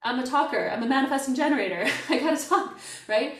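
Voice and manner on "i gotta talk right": laughing. Real, not acted. Laughing about being a talker.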